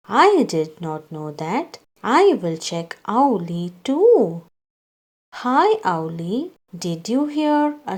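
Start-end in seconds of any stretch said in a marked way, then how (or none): none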